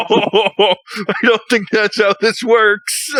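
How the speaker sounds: amused slow incredulity; mock disbelief. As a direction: spell it out slowly like it's obvious. Mocking the buyer's logic.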